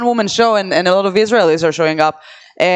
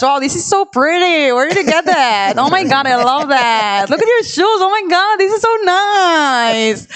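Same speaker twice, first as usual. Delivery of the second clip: put-on gushing high valley girl voice; bubbly compliments, valley girl style; 6 semitones higher than usual. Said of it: "you could do an impression of, like, a Valley Girl".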